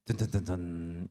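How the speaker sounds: low hummed dun dun dun dun; ominous mock drumroll; mock suspense sting. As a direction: sing it like a horror movie sting. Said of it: "mock suspense sting".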